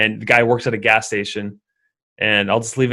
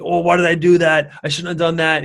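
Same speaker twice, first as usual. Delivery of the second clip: raised, louder whiny regret; mock self-reproach; 6 semitones higher, 3 dB louder than usual. Someone scolding themselves the morning after, whiny. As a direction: beat yourself up in a higher fretting voice.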